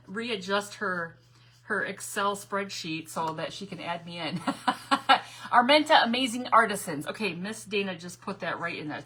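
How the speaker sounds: usual speaking voice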